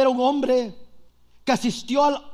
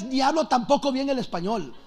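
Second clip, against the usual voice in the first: usual speaking voice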